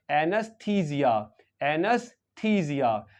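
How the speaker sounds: "एनेस्थीसिया" slow, long "थी", Z for S; pronunciation demo. Shown as a demonstration. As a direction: teach "एनेस्थीसिया" slowly as a-nus-THEE-zia. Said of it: "इस word को pronounce करेंगे".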